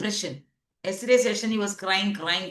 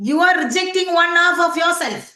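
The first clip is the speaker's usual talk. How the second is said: higher and louder, driving the point home; urgent stress on the whole line; emphatic; 8 semitones higher, 6 dB louder than usual. Real, not acted. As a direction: raise your voice on "are" to hammer it in. Therapist stressing key point.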